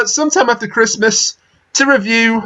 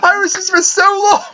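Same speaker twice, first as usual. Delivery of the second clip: laughing; 11 semitones higher, slower than usual. Real, not acted. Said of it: "laughing too hard".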